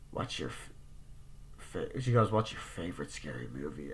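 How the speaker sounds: low, hushed killer voice; creepy caller. Retold or imitated. Killer asking Sydney her favorite movie, hushed and low. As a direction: breathe the question darkly.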